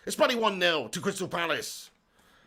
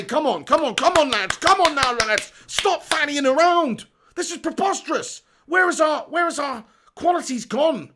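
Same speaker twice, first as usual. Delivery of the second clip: higher, louder come-on shouts; urging shout; 6 semitones higher, 6 dB louder than usual. Real, not acted. Fan yelling at the lads to stop fannying around, frustrated shout. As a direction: roar at the players to wake up.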